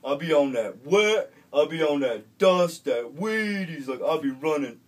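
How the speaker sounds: loud, slow street swagger voice; street tough kid. Retold or imitated. Kid boasting to the recovery group, loud street swagger. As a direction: preach your street cred to the group.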